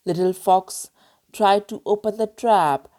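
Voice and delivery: plain reading voice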